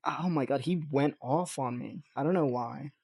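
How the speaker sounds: ordinary talk, nothing marked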